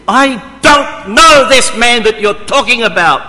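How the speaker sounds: loud, high desperate oath; swearing denial. Acted. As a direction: swear it, cursing yourself. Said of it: "call down on himself curses, and he swore".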